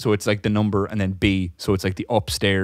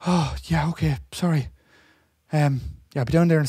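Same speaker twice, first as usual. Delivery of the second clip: imitating customer; 7 semitones higher than usual. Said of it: "And she's like".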